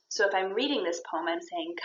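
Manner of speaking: ordinary delivery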